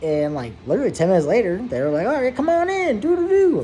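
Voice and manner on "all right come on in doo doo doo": high sing-song call; a sing-song call to come in; sing-song nurse. Retold or imitated. Voicing the nurse calling him in.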